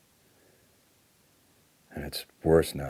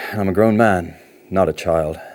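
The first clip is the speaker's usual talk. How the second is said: resentful, raised voice; indignant outburst; 3 semitones higher, 8 dB louder than usual. Acted. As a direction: push back hard with wounded pride. Bristles at the counsellor.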